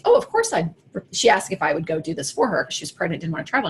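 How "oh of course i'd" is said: eager yes. Retold or imitated. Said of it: "the first thing I said was".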